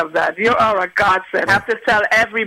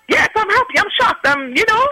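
overjoyed disbelief; 12 semitones higher than usual. Retold or imitated. Shocked by surprise credit card offer.

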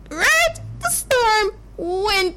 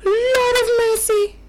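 panicked cry; 8 semitones higher, faster than usual. Acted. Disciple in the sinking boat crying out in fear.

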